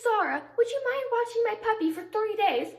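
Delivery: imitated friend's request; friend asking a favor. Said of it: "She says".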